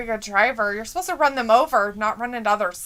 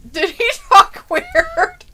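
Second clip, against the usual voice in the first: slow, much higher laughing echo; amused laughing echo; much slower than usual. Real, not acted. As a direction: repeat the question cracking up. Echoing question while laughing.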